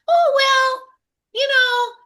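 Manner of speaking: very high, whiny put-on voice; mocking helpless voice. Speaker mimics everyone shrugging off the oligarchs, mocking helpless voice. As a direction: squeal it in mock resignation. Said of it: "sorry for my voice".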